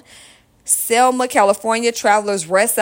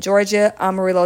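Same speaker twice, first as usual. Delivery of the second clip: ordinary talk, nothing marked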